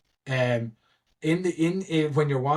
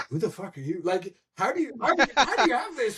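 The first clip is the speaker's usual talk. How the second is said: much higher, incredulous mock panic; bewildered squeaky disbelief; 10 semitones higher than usual. Teller voices a pro player's stunned thoughts, high and incredulous. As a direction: squeak it like your mind is blown. Voicing Rain's baffled thoughts.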